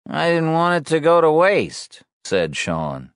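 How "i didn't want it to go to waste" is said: put-on higher, sullen teen voice; a resentful teenager; Sean's voice. Narrator voices Sean.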